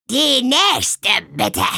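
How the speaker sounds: put-on high, croaky old witch calling the next one; raspy witch calling out the queue; croaking swamp witch. A narrator's reading. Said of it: "krächzte Tante Müffel".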